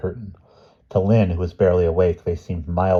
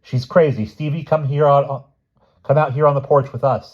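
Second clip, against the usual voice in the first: loud, higher-pitched ordering; raised, pressing voice; urgent command; 8 semitones higher, 6 dB louder than usual. A narrator's reading. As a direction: call the child away urgently. Judith urgently ordering Stevie outside.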